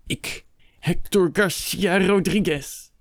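high, drawn-out outraged vow; slow, seething, wounded pride; indignant outburst. A narrator's reading. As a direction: declaim the threat until the breath fails. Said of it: "Zijn adem stokte".

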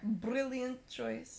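put-on drawn-out, lightly accented brilliant choice; slow, hammed-up Scottish twang. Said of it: "Got a little Scottish there".

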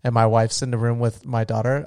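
ordinary talk, nothing marked

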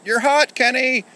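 loud high sing-out of a game clue; shout. Acted. Toy soldiers telling Kenny he is close, shouted. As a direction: shout it at the top of your range. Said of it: "and we shout".

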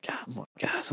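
whispered under the breath; whispered aside. Retold or imitated. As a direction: whisper it in confusion. Confused aside about the waiter.

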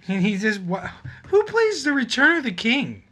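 high, loud incredulous outburst; excited disbelief. Real, not acted. Amazed friend's bar plays Return of the King, excited. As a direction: exclaim loudly, high, in delighted disbelief.

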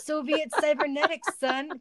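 high-pitched mock shout; comic shout. A narrator's reading. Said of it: "Kanye shouted".

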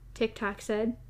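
ordinary delivery

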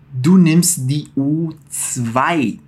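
very slow, zwei starting with a crisp ts; slow sound demo. Shown as a demonstration. Teacher slowly models the ts sound for students. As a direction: stretch it out and land the ts in zwei. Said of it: "também conta com esse som".

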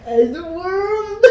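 drawn-out, high mocking wail; mock heavy, slow voice. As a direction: make fun of her groggy call, dragging it out. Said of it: "my voice was so heavy and slow".

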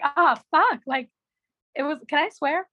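ordinary delivery

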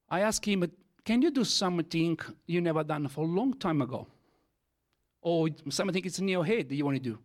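usual speaking voice

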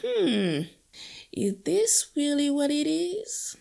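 pondering hum into a high-pitched question; doubtful thought. Retold or imitated. She questions the character's happy picture of Antigua, doubtful high musing. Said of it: "And I thought".

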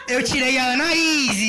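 drawn-out, triumphant announcement; excited reveal. Real, not acted. Host revealing whom they drew, excited announcement. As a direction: stretch out the big reveal. Big reveal of the secret friend.

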